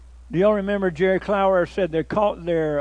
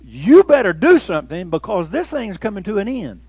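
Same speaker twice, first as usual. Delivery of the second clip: loud preacher's warning to the world; booming angelic warning; angel's warning; 3 semitones higher, 7 dB louder than usual. Acted. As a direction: proclaim it loudly as the angel. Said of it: "And he says".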